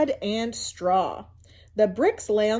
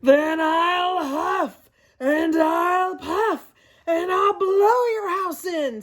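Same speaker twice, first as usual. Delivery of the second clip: slow, loud, drawn-out villain voice; big bad Coyote threat; 6 semitones higher, 4 dB louder, slower than usual. Coyote menacing the javelinas, slow and loud. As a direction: stretch every word like a hungry predator.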